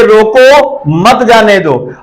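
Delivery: drawn-out रोको, then मत जाने दो as one unit; pause after रोको. Shown as a demonstration. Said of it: "यानी कोई व्यक्ति है उसे".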